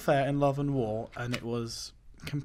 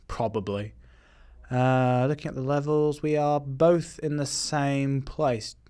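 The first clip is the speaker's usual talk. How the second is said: usual speaking voice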